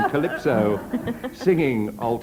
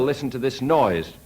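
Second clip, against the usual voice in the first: ordinary delivery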